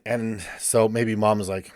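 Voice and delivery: usual speaking voice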